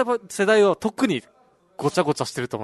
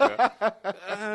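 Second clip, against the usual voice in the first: long, higher stretching "uuun"; imitated stretching groan; 4 semitones higher, much slower than usual. Imitating the friend stretching in the sun, drawn-out groan. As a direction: draw out a mock groan while stretching. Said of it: "って伸びしながら".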